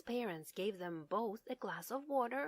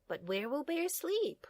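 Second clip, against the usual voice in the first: higher, gentle mother voice; mother's voice; 6 semitones higher than usual. Acted. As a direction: voice the mother lightly.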